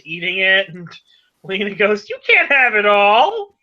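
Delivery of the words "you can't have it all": high, loud protest; loud, high-pitched objection; indignant outburst. Acted. Character objects over the popcorn.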